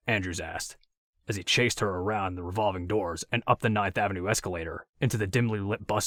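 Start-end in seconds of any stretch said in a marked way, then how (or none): none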